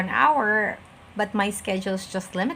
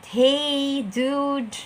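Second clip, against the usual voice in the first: drawn-out, higher-pitched "hey, dude"; casual slang greeting; 6 semitones higher than usual. Shown as a demonstration. Teacher showing clients' slang tone, casual drawn-out greeting. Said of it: "not to speak in that tone".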